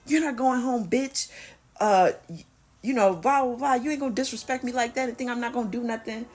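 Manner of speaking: mock menace of an angry boyfriend. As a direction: voice the man snapping back threats. Boyfriend threatening after hitting her.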